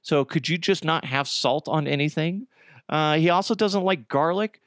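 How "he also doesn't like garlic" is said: usual speaking voice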